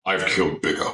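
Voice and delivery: lightly voiced stern god, very high on boy; a hint of a gruff warrior father; mock Kratos line; Kratos impression. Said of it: "he's just like".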